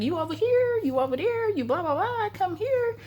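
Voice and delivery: friends calling out. Retold or imitated. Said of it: "because I can hear them saying".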